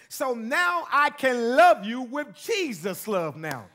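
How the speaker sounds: slow, swelling into a loud sermon; building preaching. Acted. Pastor preaching love of God to congregation, rising.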